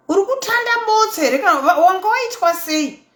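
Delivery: high, fast, put-on nosy voice; a hammed-up busybody prying; mock nosy viewer. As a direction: squeak out the gossip's question. Mocking question from viewers.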